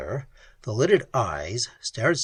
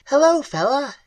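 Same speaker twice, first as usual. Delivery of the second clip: much higher, louder, clicking creature voice; 13 semitones higher, 6 dB louder than usual. Acted. Ice creature echoing Pat's greeting, clicking high. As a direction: echo it back high and alien. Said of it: "clicking, high-pitched voice".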